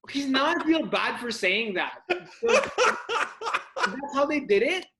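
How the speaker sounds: laughing in disbelief; words broken by laughter. Real, not acted. Both laughing at the story.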